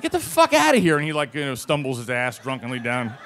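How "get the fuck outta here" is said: loud, high-pitched order to leave; acted angry dismissal. Officer sends student away, acted angry shout. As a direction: chase him off with a raised voice.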